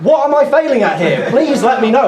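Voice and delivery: high-pitched, indignant demand; mock outraged protest; exasperated pleading. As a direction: plead for an explanation, comically worked up. Acting out exasperated reply to stranger.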